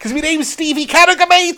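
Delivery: high-pitched, laughing fake Scouse; badly faked Scouse boast; Stevie Carragher in mock Scouse. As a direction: overdo the Liverpool accent. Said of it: "that accent was proper abysmal".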